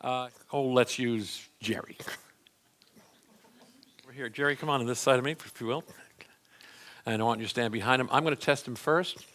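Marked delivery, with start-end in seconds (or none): none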